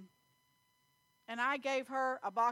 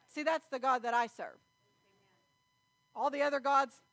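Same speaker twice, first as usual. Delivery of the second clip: raised, fervent preaching voice; 4 dB louder than usual. Acted. Preacher exhorting the congregation about God's blessing.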